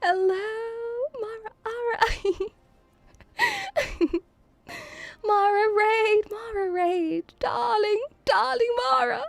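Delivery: high, gleeful raid welcome; excited cheer. Real, not acted. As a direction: call out to a darling friend, high-pitched. Cheering a friend's incoming raid.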